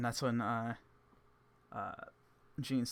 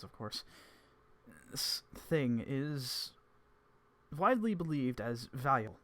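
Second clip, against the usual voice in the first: Evans voice; 2 semitones higher, 5 dB louder than usual. Acted. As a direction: weigh each word like a scholar.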